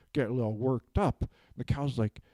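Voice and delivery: normal talking voice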